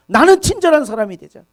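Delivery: rally the congregation; preacher's rousing declaration; impassioned vow. Acted. Preaching peak.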